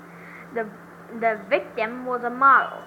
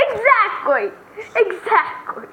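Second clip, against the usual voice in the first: high, loud exclamation; loud shout; 8 semitones higher, 4 dB louder than usual. Acted. Witness shouts agreement.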